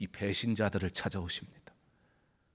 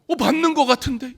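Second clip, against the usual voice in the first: high, loud, teasing suspicion; nosy bystander; 12 dB louder than usual. Acted. A bystander beside Peter chimes in, high and loud. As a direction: sing it out like a busybody. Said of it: "그랬더니 옆에 사람이".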